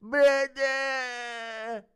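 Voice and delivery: hammed-up despairing yell, drawn out; Arman's outburst. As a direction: bellow it like a rich fighter getting bad news. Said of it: "Impression of Arman finding out the news today".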